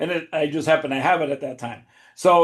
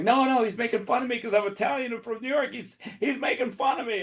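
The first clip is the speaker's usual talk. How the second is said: high-pitched mock outrage; imitated upset complaint; 8 semitones higher, 4 dB quieter than usual. Italian woman complaining to the floor manager, upset. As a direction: mimic her protesting to the floor manager, pitched up. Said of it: "she lost her mind".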